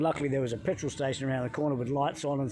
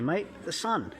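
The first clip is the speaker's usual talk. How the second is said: usual speaking voice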